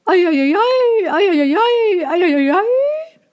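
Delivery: very high, loud cooing chorus; both doves ask in chorus, very high. A narrator's reading. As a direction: coo together like a pair of pigeons. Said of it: "fragten sie im Chor".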